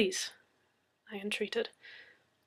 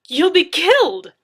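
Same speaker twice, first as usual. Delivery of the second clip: alarmed shout; 9 semitones higher, 16 dB louder than usual. Acted. Leslie warns Anna she will die, loud. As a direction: shout the warning at her.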